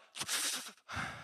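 wheezy, voiceless mock sobbing breaths; asthmatic crying imitation. Shown as a demonstration. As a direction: imitate gasping for air while crying. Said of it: "you're convinced they have asthma".

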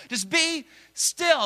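high, slow be still; parent telling kids. Retold or imitated. Parent to young children, high slow be still.

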